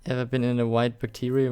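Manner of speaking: ordinary talk, nothing marked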